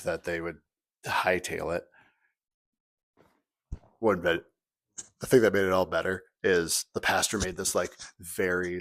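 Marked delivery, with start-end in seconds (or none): none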